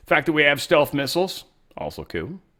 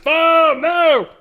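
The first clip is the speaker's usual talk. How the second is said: mock shriek; 10 dB louder than usual. Mock alarm at balsa gliders.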